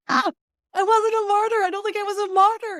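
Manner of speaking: high, loud and quick, bursting with pride; excited retelling. Retold or imitated. Telling her daughter she beat being a martyr, high and thrilled. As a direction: squeal it out like you finally made it.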